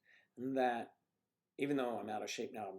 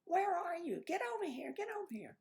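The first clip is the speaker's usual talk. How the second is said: much higher mock wife, calling him over; imitating his wife. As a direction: squeak her call like the worried wife. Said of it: "and she's like".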